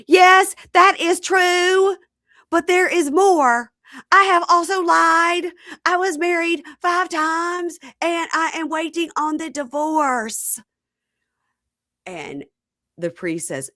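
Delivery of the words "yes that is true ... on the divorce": very high, slow wail; overwrought, stretched-out high voice; mock dramatic confession. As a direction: confess loudly in a hammed-up wail. Acts Lisa's dramatic confession.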